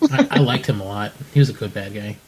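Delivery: ordinary talk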